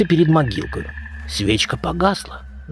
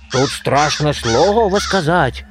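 plain reading voice

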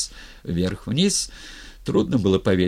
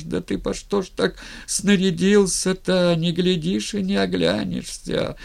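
mournful village keener, voice held high; keening lament; 9 semitones higher, 4 dB louder than usual. A narrator's reading. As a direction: lament like an old woman over the dead. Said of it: "шла и причитала".

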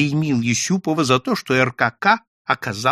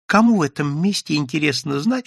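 ordinary delivery

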